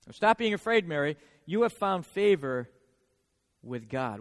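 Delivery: gentle scripture read. Acted. Reading Gabriel's words to Mary.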